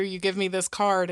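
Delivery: ordinary delivery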